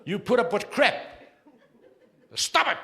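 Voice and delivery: high, loud mock yell; scolding shout. Comic telling the women off, a high mock yell. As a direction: shriek it at them.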